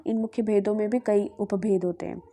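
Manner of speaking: ordinary talk, nothing marked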